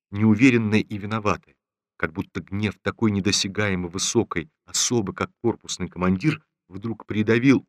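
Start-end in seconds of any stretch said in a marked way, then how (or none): none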